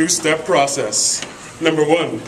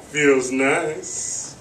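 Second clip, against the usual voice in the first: normal talking voice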